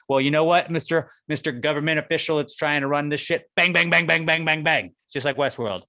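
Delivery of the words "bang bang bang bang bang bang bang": gun noises. Pretend. Robots shooting officials.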